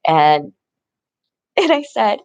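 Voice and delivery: ordinary delivery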